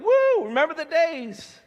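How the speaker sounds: slow, hammed-up cheer; mock cheer. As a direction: whoop it like a crowd at a party. Congregation cheering remembered feast.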